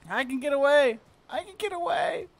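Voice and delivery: high anxious hope; scared horror game streamer. Real, not acted. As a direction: say it while fleeing. Fleeing enemies.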